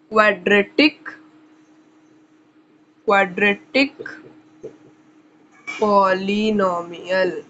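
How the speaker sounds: drawn-out dictation. Real, not acted. Teacher writing up the second topic, drawn-out dictation. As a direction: stretch each term out while writing. Writing new heading while saying it.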